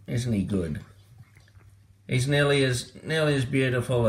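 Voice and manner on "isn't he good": whisper. Real, not acted. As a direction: whisper fondly over the cat. Fond whisper about the cat.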